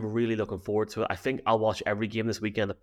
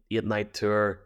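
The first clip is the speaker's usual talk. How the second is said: ordinary talk, nothing marked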